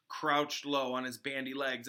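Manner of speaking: plain reading voice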